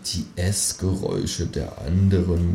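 soft, slow mumble; murmuring. A narrator's reading. Man listening in the dark, murmuring. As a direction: murmur slowly to yourself. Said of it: "murmel ich".

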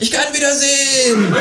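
high, joyful kangaroo shout. Acted. Kangaroo kissing the ground outside, high joyful shout.

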